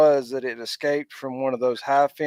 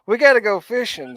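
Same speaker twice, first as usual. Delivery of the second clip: louder, higher imitation of her pestering; retold urging; 7 semitones higher, 6 dB louder than usual. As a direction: mimic her pushing for the next outing. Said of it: "now she's going".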